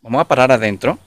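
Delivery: ordinary delivery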